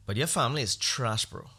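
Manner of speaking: ordinary delivery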